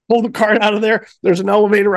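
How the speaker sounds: usual speaking voice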